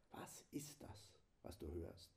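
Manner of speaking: unvoiced breathy whisper. Shown as a demonstration. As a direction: whisper the question softly into the silence. Meditative demo of silent mass. (geflüstert, stimmlos)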